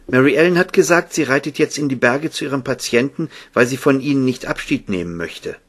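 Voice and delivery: put-on high little-girl voice; Elizabeth's report. A narrator's reading. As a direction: pass on the message in a high, girlish voice. (hohe Mädchenstimme, berichtend)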